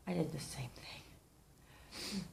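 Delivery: whispered confession, very soft and slow; sheepish hushed whisper; guilty whisper. Real, not acted. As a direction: whisper it like admitting a sin. Confessing she repeated mistake.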